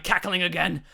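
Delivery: plain reading voice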